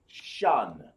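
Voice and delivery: "Shun" low and falling in one syllable; one joined sound. Shown as a demonstration. Teacher showing how dependent sounds join, "Shun" as one syllable. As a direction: run the sh of "Shun" straight into a long n. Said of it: "this whole sound".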